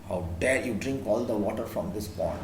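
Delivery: king of beasts rebukes the donkey, low voice dropped down; lion's roar. A narrator's reading. Lion challenges the donkey, deep low anger. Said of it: "The lion roared".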